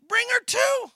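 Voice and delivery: high, loud shouted welcome; shouted eager invitation; enthusiastic shout. Retold or imitated. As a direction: call it out with open arms. Pastor welcomes friend.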